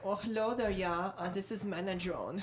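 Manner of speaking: comic put-on German accent. Shown as a demonstration. Host showing co-host the accent, mock German. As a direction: greet with a hammy German accent. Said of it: "do it in a German accent".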